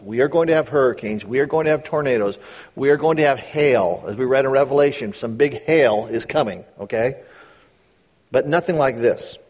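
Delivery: usual speaking voice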